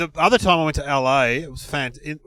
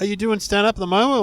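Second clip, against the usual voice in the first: lightly imitated eager star, higher pitch; Robin Williams; 7 semitones higher, 3 dB louder than usual. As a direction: act out keen, friendly interest. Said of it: "And Robin was like".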